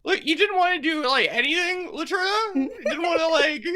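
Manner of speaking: high-pitched mock indignation; exasperated reproach. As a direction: complain in a high voice to the friend who stood by. Freed character reproaches idle companion.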